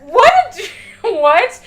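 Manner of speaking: high, loud delighted what; shocked and amused; surprised. Real, not acted. Reacting to the Hanar voice.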